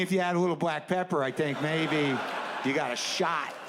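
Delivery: usual speaking voice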